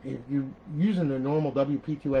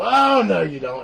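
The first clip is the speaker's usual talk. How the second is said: mock Microsoft; 5 semitones higher, 9 dB louder, slower than usual. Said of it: "and go".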